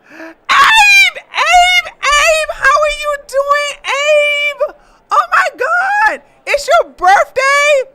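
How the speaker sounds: high shouted calling of a name; excited calling. Real, not acted. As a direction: yell across the room to a friend. Calling out to viewer Abe.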